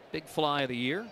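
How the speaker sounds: ordinary talk, nothing marked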